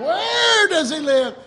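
high, loud, drawn-out question; yelled challenge. Retold or imitated. Preacher translating his challenge for listeners, yelled. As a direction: shout it slowly to the whole room.

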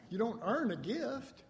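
ordinary delivery

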